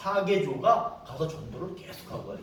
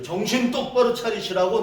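loud, high-pitched scolding; forceful rebuke; 6 semitones higher, 8 dB louder than usual. Retold or imitated. Preacher confronting street missionaries, forceful rebuke. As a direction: raise the voice sharply to jolt them. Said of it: "내가 얘기를 해줘".

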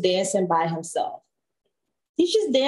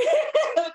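laugh. Real, not acted. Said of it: "That's how she talks".

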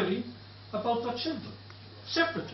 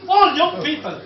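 retold shout; 4 semitones higher, 7 dB louder than usual. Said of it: "the German shouted up to me".

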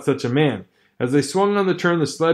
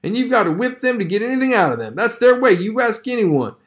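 higher, heated brother's voice; Hal scolding; 9 semitones higher than usual. Acted. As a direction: argue hotly with his sister. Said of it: "her brother sneered".

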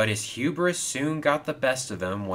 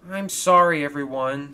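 mildly sad, raised-pitch apology; 6 semitones higher, 6 dB louder than usual. A narrator's reading. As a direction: apologise in a high, sorry voice. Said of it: "said Kevin sadly".

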